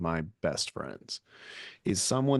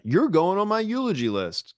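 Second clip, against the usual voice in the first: mock road-rage threat; 9 semitones higher than usual. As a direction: call it out to a stranger in traffic. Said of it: "start telling people in traffic".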